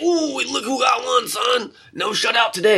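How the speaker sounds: high, mocking opponent jeering; mocking taunt. Opposing player taunting the team after their one goal, high mocking jeer. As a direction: sneer at the losers, pitched high. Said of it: "have it be like".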